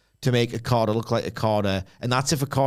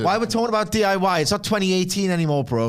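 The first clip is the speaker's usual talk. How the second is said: mock rant; 6 semitones higher than usual. Mock rant about outdated move.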